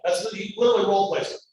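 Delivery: ordinary talk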